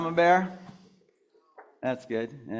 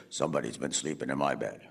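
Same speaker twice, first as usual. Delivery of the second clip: very low, softer bear rumble; Papa Bear; 10 dB quieter, faster than usual. Shown as a demonstration. Performer feeds Papa Bear the bed line, deep low voice. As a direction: sink right down for the father bear.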